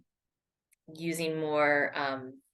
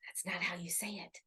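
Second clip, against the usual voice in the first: breathy unvoiced whisper of dismay; soft aside to herself, let down; 15 dB quieter than usual. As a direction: whisper it as if caught out by your own mistake. Dismayed after looking the word up.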